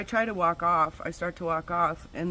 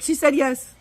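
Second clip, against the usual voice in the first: much higher, louder friend blurting it out; excited friend; 9 semitones higher, 11 dB louder than usual. Retold or imitated. Friend cheering that the crying girl agreed, high and loud. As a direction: burst out with the good news. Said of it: "her friend's like".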